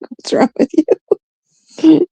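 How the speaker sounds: high, hurried mocking imitation; mimicked indignant reproach. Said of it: "She's like".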